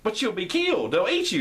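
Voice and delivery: high, panicked warning; alarmed warning. Retold or imitated. Officials warning Tyrion, high and alarmed. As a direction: warn him he'll be eaten.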